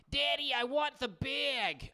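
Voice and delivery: drawn-out mock Long Island accent. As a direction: imitate a little kid from Long Island. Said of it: "talking like you're from Long Island".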